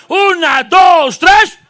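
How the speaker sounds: rally the congregation with a big count; counting off loud. Real, not acted. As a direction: call out the count to cue the crowd. Counting off a loud group shout.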